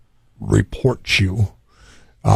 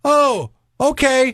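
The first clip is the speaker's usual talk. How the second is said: much higher, slow mock little "oh okay"; deflated neighbor; slower than usual. Neighbor gives up on the car washer, high slow meek voice. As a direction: let the busybody fold meekly. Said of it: "the lady's like".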